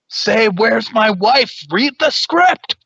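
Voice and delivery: high, slow, far-off director. Acted. Offstage director feeds the line, high and slow. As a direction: coach the actor through the line slowly. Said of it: "The guy behind the camera says".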